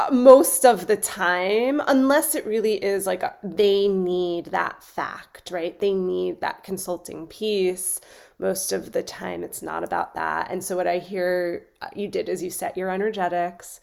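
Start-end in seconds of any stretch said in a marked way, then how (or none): none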